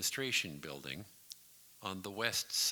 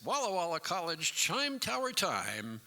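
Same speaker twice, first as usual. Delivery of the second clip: loud, high, hammed-up time call; mocking big announcer shout; overblown station announcement; 9 semitones higher, 5 dB louder than usual. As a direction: belt it out like a showy announcer. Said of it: "none of this".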